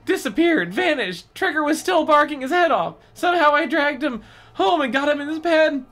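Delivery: Evan's voice, pitched well up and loud; high-pitched frightened boy narrating; high boy's voice. Acted. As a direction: read it as a scared kid, up high. Evan's tale voiced, read aloud.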